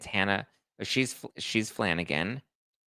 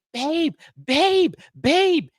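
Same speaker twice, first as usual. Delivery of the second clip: very high, loud whining calls; frantic mock wail; 8 dB louder, much slower than usual. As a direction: wail for babe like a frantic drunk. Frantic calling boyfriend.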